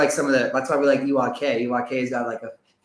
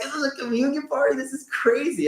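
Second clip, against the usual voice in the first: mock amazed exclaim; 13 semitones higher than usual. Friends mock their own shock.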